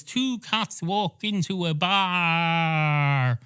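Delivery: slow, drawn-out storyteller line; traditional story opener. Acted. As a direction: stretch it out like an old bar tale. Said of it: "the type where he goes".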